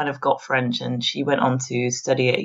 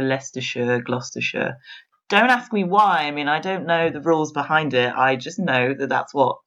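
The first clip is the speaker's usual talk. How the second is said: ordinary delivery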